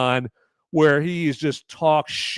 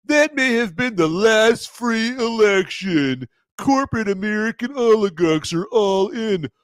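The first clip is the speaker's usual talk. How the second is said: mock reading Cusack; 7 semitones higher, 4 dB louder than usual. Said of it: "John Cusack weighing in".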